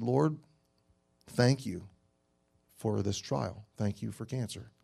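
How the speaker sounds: quiet prayer. Retold or imitated. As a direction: whisper the thanks gently, like a quiet prayer. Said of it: "and I said".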